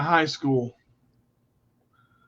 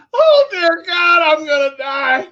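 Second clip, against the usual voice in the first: high, crying mock yell of terror; mock scream; 10 semitones higher, 4 dB louder than usual. Retelling skydive to waitress, hammed-up yell of panic. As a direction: ham up a skydiver sure he'll die. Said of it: "I'm screaming".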